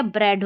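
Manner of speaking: ब्रेड with an e vowel; correct reading. Shown as a demonstration. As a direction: say ब्रेड with e, neither i nor ee. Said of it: "तो ये ब्रेड है". (सही उच्चारण: ब्रेड, ए की मात्रा के साथ)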